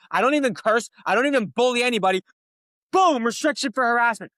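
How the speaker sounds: loud, high, heated venting; frustrated rant. Real, not acted. Streamer ranting to chat about TikTok, loud and furious. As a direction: blow up about being restricted. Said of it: "it's so frustrating".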